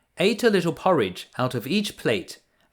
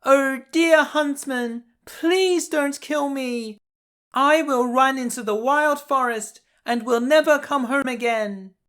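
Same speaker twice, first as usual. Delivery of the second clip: put-on pleading girl; 11 semitones higher than usual. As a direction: beg the huntsman for your life. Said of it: "she began to cry".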